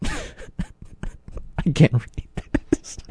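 breaking up with laughter, words breathy and quiet; can barely get the words out for laughing. Real, not acted. Host cracking up while reading the bee story, laughing.

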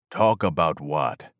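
low, slow, clipped-back menace; a guarded warrior voice; cold demand. A narrator's reading. The gunman asks the trespasser coldly, low and slow.

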